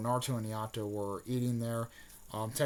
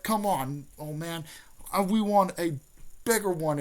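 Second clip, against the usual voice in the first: put-on higher, louder whiny kid voice; a pushy kid nagging for more; pleading boy, pitched up and loud; Naruto impression; 6 semitones higher, 5 dB louder than usual. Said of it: "but Naruto was like".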